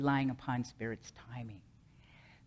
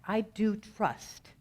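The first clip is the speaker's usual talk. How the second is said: slow stress on "I do", higher pitched; stated as fact; 6 semitones higher, much slower than usual. Shown as a demonstration. Speaker contrasting fact with action, slow firm "I do trust". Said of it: "And then it is a fact".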